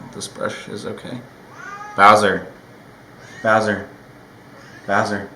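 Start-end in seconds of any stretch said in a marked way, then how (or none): 2.0-5.3 loud call to the cat, fading off with each repeat. Real, not acted. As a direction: call the cat sharply, then ease off. Calling the cat by name.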